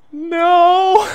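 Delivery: put-on a kid refusing the fight in panic; panicked squeak. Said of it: "I'm like".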